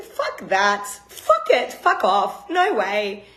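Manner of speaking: telling the neurologist where to go, fiercely; defiant refusal. Retold or imitated. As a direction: spit it back at the doctor's verdict. Defying the neurologist's grim prognosis.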